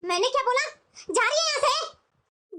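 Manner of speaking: high-pitched, insistent reprimand; stern scolding. Acted. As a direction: order her out, brooking no argument. Mother-in-law scolds reluctant daughter-in-law. (सख़्ती से डाँटते हुए)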